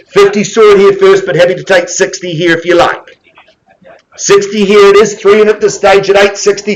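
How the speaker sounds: auctioneer calling. Acted. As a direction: rattle off the numbers like a live auctioneer.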